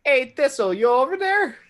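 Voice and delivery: loud call to a distant booth; yelling across. Acted. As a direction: shout over to the paladin's booth. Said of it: "I yell in t".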